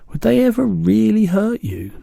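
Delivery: put-on a small girl asking anxiously; high childlike voice; Sophie. A narrator's reading.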